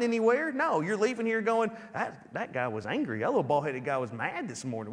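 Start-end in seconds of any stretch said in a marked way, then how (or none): 1.9-4.9 lower, softer gossiping aside; lightly voiced congregant grumbling; muttering churchgoer. Congregant leaving church, muttering about the pastor.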